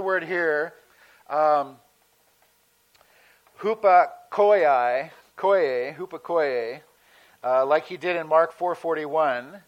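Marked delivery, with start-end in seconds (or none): 3.6-6.8 "hupakoiai" stretched very slowly, then "koie", then settling on "hupakoie" with a rise; groping for pronunciation. Shown as a demonstration. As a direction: feel your way to "hupakoie", trying the syllables slowly before landing on it. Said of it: "how they really pronounce these".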